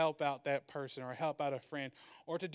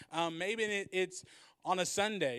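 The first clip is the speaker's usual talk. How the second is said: ordinary talk, nothing marked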